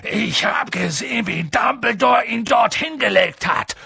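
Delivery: high, forceful accusation; outraged. A narrator's reading. Giant accuses the uncle over the boy, outraged. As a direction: press the charge in a high, heated voice.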